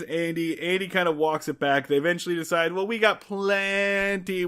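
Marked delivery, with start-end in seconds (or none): 2.7-4.4 "plenty" with a long drawn-out E, slowly; stretched plenty. Retold or imitated. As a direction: draw out the E in "plenty". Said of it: "with, like, five E's".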